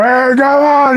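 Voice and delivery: high, loud mock yell; retold yell. Driver yelling at his girlfriend outside the restaurant, high and loud. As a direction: holler impatiently at the girlfriend. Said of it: "He's yelling at her".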